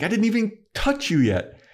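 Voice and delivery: high-pitched, exasperated objection; mock indignant protest. Buffy to Willie who yelped early, mock indignant protest. Retold protest at fake yelp.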